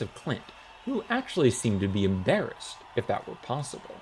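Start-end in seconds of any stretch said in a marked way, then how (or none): none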